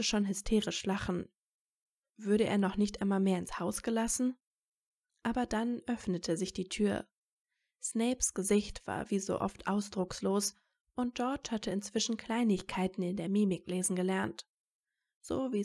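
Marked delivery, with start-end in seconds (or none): none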